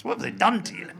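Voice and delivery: high, loud mock-appalled neighbour; Missus Whitehouse. People in Scotland reacting to his accent, high mock dismay. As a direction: lift it into a horrified busybody cry. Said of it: "people say, rather like Missus Whitehouse".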